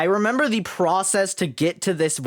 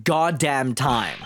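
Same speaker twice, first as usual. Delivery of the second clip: low, slow, subdued character voice; weighty, drawn-out dramatic delivery; grave game character; 5 semitones lower, slower than usual. Acted. Character facing the end for that man, grave.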